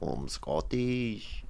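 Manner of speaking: very slow, soft Scottish impression; Fat Bastard. Quoting a film Scot to friends, slow Scottish accent. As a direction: draw out each word in a hushed Scottish accent. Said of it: "What does he say?".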